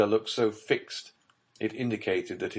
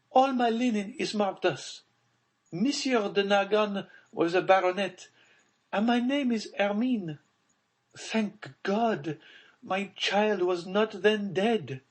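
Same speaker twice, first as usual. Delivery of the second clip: Baroness voice; 13 semitones higher than usual. Acted. Baroness demanding of Villefort about her buried child, high and frightened. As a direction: pitch her up high, a mother in dread.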